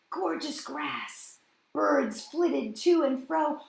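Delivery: usual speaking voice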